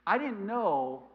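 ordinary delivery

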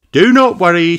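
bright helpful rabbit voice; Miss Rabbit. Acted. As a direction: reassure as a cheery rabbit.

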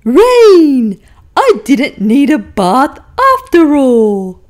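donkey thrilled the rain came; delighted exclaim. Acted. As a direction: greet the rain with glee.

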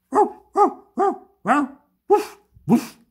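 high, loud yapping woofs; dog barking. A narrator's reading. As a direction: bark like a small watchdog, very high. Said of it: "Kannst du bellen?". (hohes, lautes Hundegebell)

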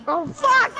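horrified surprise. Real, not acted. Sudden death in game.

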